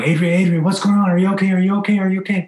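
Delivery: rapid worried calls rising high, acted out; retold panicked yelling. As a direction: call out urgently, high-pitched.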